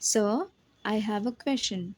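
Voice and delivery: slow, careful "Sir, I have a question"; pronunciation demo. Shown as a demonstration. Teacher shows students the pronunciation, slow and deliberate. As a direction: say each word slowly so learners can copy. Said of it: "इसका pronunciation है".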